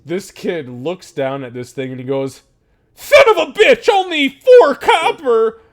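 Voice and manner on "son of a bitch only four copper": very high, very loud shout, half laughing; excited kid yell. Acted. The kid yelling with joy at getting four copper. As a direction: scream it with wild glee.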